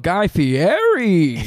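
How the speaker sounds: slow, loud preacher cadence; Pastor Puppet inflection. Pretend. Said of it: "the Pastor Puppet inflection".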